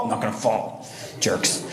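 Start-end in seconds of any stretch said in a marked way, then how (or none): none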